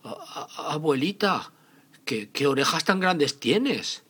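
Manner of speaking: put-on high, loud, stammering child; scared little girl. Caperucita frightened by the wolf.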